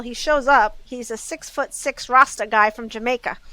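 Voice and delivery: ordinary talk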